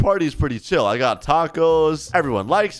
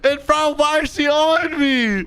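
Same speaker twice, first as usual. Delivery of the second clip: flustered disbelief; 10 semitones higher than usual. Pretend. Player with a girl lying on him, flustered. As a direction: protest in a stretched high voice.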